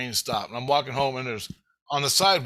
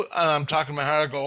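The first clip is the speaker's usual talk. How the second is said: ordinary talk, nothing marked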